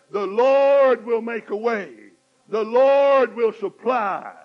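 loud, slow bold declaration; fervent proclamation. Acted. Preacher to congregation, loud fervent declaration. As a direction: proclaim it triumphantly.

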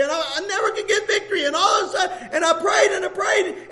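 ordinary delivery

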